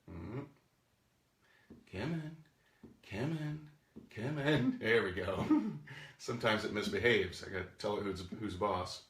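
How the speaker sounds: ordinary delivery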